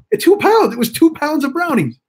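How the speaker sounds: high-pitched emphatic outburst; exasperated exclamation. Real, not acted. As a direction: exclaim at the sheer amount, pitch leaping up. Exclaiming at the amount of brownies.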